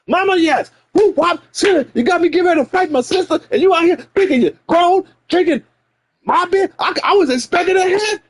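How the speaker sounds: high, fast mock mama shout; scolding mama. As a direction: tear into the kid like an angry mother. Mama scolding kid over beer.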